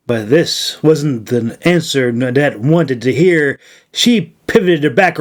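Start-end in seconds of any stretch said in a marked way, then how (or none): none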